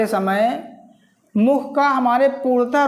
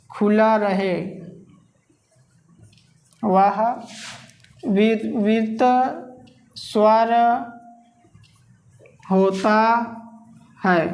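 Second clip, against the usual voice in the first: slow, word by word with long pauses; dictation; 3 semitones lower, slower than usual. Shown as a demonstration. Teacher dictating a definition for students' notebooks. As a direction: dictate it so the class can write.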